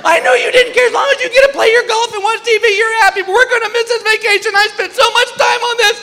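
very high, wailing fake sob of a wife; hammed-up teary wife, whining high; wife wailing at her husband in the line, mock sobbing; mock crying wife. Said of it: "Here comes the tears".

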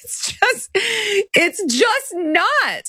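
very high squeal through laughter; real helpless laugh, voice shooting way up; laughing disbelief. Real, not acted. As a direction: crack up while protesting, stretching the last words. Cracks up during incredulous rant.

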